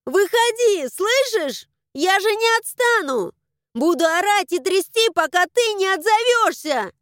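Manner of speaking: high, loud, urgent shouting; frantic high-pitched holler; straining desperate yell. A narrator's reading. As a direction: scream for an answer, straining the voice. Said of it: "заорала ещё громче, срывая голос".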